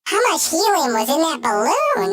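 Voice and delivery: squeaky chipmunk effect; helium voice. Shown as a demonstration. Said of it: "make my voice go really, really, really high".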